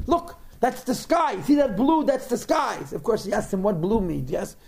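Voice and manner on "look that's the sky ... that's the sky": high, insistent giraffe voice; insistent pointing-out. Acted. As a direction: point it out eagerly, pitched high. Giraffe insisting to puzzled goat.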